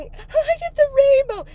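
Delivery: high, loud tearful cry. Retold or imitated. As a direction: shout it through tears at strangers. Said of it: "ugly running".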